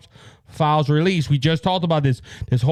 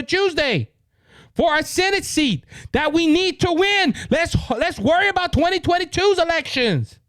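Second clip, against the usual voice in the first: worked-up retelling; 8 semitones higher than usual. Retold or imitated. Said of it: "And I'm like".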